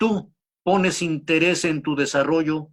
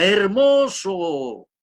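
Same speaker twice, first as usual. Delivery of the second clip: slow, drawn-out shout, higher and louder; hopeful child shouting into the cave; child's shout; 5 semitones higher, 4 dB louder, much slower than usual. Retold or imitated. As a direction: belt it out long and slow into the cave. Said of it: "Y el niño grita".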